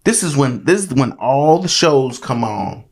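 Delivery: ordinary delivery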